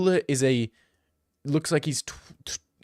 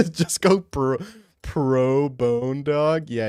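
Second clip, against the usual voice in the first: usual speaking voice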